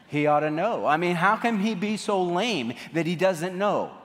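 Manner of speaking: mock exasperated griping, voice pushed up; indignant mimicked complaint.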